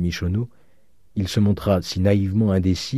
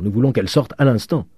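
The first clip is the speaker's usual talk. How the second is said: louder, higher demand; raised voice of protest; crowd crying out; 5 semitones higher, 4 dB louder than usual. A narrator's reading. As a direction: call out as angry lodgers. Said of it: "crièrent les pensionnaires".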